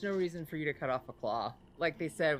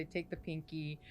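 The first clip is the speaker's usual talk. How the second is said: quiet; 8 dB quieter than usual. Acted. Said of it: "hold out and say".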